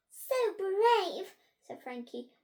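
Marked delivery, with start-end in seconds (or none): 0.1-1.3 swooning pupil. A narrator's reading. Frankie swoons over the prince.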